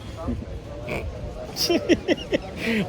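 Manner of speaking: high-pitched burst of laughter. Real, not acted. Laughing at his state.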